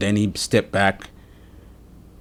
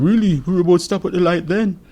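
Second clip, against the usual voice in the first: much higher and louder, disbelieving; retold incredulous protest; 11 semitones higher, 6 dB louder than usual. Arrested driver disputes officer's reason, incredulous protest. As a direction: replay your own raised voice challenging the excuse.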